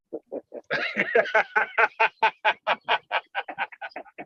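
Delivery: long, rhythmic belly laugh. Real, not acted. Laughing at a friend's private joke, long helpless laugh. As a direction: keep laughing in a long run of bursts.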